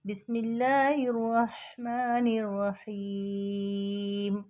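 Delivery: slow, melodic tajweed chant; Quran recitation. Shown as a demonstration. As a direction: recite slowly with drawn-out vowels. (धीमी सुरीली तिलावत)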